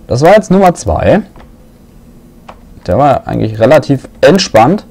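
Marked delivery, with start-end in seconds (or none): none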